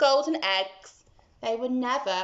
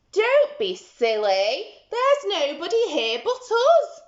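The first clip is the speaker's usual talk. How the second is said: a hint of a scolding giantess; 7 semitones higher, 5 dB louder than usual. Giantess scolds the giant, mildly stern. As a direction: tell him off briskly. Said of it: "the giantess scolded".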